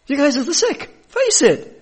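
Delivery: high, loud teasing voice; Jesus teasing the tax collectors; playful jab. Retold or imitated. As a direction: rub it in with a grin.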